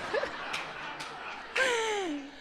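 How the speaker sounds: long soft high laughter falling away. Real, not acted. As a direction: laugh tenderly. Laughs at the memory.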